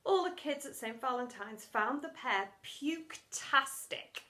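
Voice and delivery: usual speaking voice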